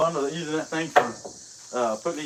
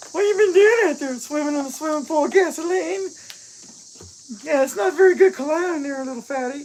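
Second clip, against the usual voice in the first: high, mock-shrill wife voice, much higher and louder; imitating the wife; 11 semitones higher, 6 dB louder than usual. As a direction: tease her by squeaking out her scolding. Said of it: "I don't sound like that".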